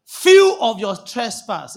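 "Few" punched very loud and stretched; stress on FEW. Shown as a demonstration. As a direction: hit "Few" hard as a deliberate misreading. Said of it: "Is that what it says?".

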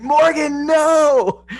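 high, loud warning shout; retold yell. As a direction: yell the name and a long, drawn-out no. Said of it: "I was yelling at Morgan".

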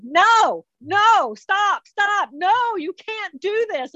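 high, urgent pleading from the friend; pleading protest. Retold or imitated. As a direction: beg her to stop, pitched high. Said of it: "And she's going".

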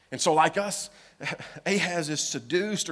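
ordinary delivery

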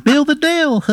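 squeaky high cartoon pickle calling out; little pickle shouting up from the plate; tiny pickle voice. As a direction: call up from below in a tiny squeaky voice. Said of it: "He goes".

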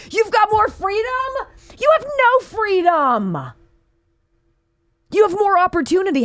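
mock the claim in a high voice. Mocking Pelosi's claims.

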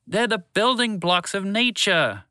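high, slow, emphatic exasperation; frustrated shout. A narrator's reading. Shala explaining particles to Walter, frustrated shout. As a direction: spell it out in high-pitched frustration. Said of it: "Shala shouted in frustration".

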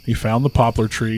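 ordinary delivery, nothing marked

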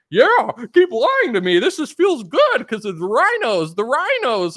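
high, loud, slow happy-fan voice; mock eager fan. Fans begging [name] to keep lying, high slow mock delight. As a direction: beg to be lied to, dreamily.

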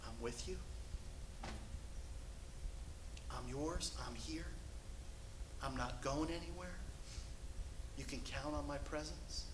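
soft, near-whispered reassurance; tender hushed promise. Retold or imitated. God softly reassuring his people, hushed and tender. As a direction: breathe it gently like a close comfort.

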